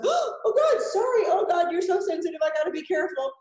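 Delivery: very high, loud, gushing sorry in a puppy voice; apologetic puppy. Retold or imitated. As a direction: play the startled puppy apologising. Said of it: "your puppy goes".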